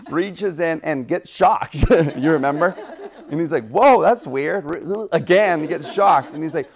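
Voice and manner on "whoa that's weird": loud retold bafflement; acted-out jolt of surprise; mock startled. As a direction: play startled and puzzled, loudly. Acting Joseph shocked by the box.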